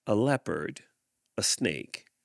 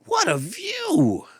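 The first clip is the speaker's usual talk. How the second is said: awed leopard; 5 dB louder, slower than usual. Acted. Leopard at the top.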